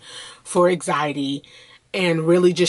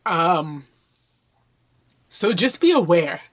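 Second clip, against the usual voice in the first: usual speaking voice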